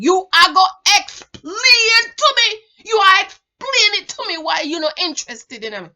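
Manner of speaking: loud, high, put-on Jamaican man's voice; hammed-up pushy suitor demanding answers; mock demanding man. Mimics demanding Jamaican men.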